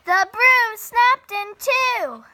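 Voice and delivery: loud, high and slow, the broom breaks; dramatic exclamation. A narrator's reading. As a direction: stretch it out in alarm. Dramatic climax of story.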